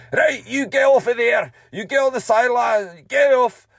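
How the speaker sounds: loud high shouting order; granddad shouting at player. Retold or imitated. Granddad yelling at a player to get off, loud shout. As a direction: bellow him off the pitch.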